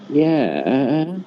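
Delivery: drawn-out, wavering 'yeah' that rises at the end; unsure, long-stretched yeah; not understanding. Acted. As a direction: agree while clearly not understanding. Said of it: "They didn't understand".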